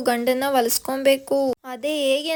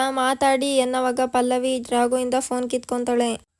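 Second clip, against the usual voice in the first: plain reading voice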